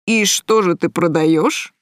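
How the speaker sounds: slow, suggestive guy's voice; cocky thug toying with the shopkeeper; leering customer. A narrator's reading. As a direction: draw it out like a sleazy come-on. Leering guy questions the shopkeeper.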